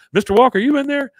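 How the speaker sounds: loud, high call through a closed door. Retold or imitated. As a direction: shout it so he hears through the door. Hank calling through a door.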